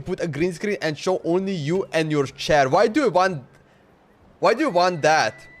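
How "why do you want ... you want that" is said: ordinary delivery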